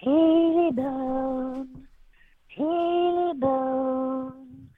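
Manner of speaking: high, slow, drawn-out ghostly wail; haunting voice. Acted. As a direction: moan it like a ghost in the woods. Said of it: "he hears".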